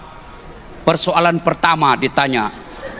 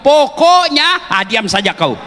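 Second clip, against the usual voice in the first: high, drawn-out, slow "Pokoknya" like a wife who won't hear more; nagging wife; 6 semitones higher, slower than usual. Retold or imitated. Preacher mimics a wife ending the argument, high and drawn out. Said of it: "Apalagi kalau dia sudah bilang".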